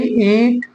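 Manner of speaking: ईट stretched slowly with a rising end; teacher drawing out ईट for students; slow model word. Shown as a demonstration. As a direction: model ईट with a long ई. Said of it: "ईट में ई का pronunciation".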